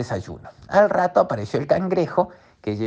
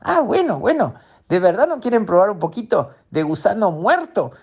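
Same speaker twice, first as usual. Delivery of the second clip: high character voice; 7 semitones higher than usual. Acted. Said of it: "crab offers worm".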